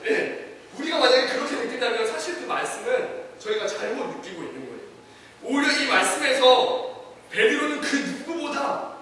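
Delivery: ordinary talk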